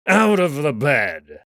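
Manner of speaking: loud, slow and drawn-out command; slow furious order. Acted. Kato furiously ordering them out of bed. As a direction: spell out each word of the order in rage.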